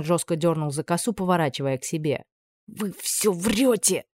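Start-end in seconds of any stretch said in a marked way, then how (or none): 2.7-4.1 slow, strangled rasp, choked off; in a chokehold. A narrator's reading. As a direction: squeeze it out while choked. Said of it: "прохрипела я сдавленно".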